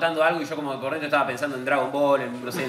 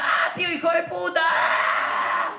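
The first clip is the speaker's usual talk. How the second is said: put-on much higher, crying little girl's shout; crying child yelling; 13 semitones higher, much slower than usual. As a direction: wail it like a hurt child. Niece crying and yelling at uncle.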